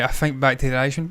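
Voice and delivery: normal talking voice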